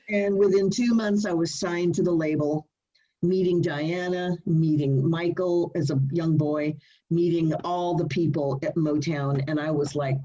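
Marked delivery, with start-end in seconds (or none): none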